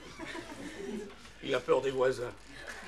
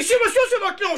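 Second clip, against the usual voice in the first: high, loud squeaky lady voice; neighbour lady; 14 semitones higher, 6 dB louder than usual. Retold or imitated. The neighbour lady accuses the owner, high insistent voice. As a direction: insist like a shrill neighbour woman. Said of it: "Elle me dit".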